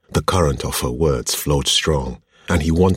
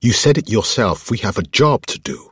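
higher, louder annoyed protest; 8 semitones higher, 5 dB louder than usual. A narrator's reading. Woman reminding her partner of the job, annoyed. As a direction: rein him in with raised, impatient voice. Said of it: "lips thinning in annoyance".

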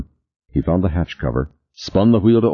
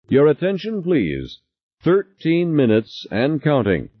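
intercom announcer; 10 semitones higher than usual. A narrator's reading. Habitat intercom announces countdown.

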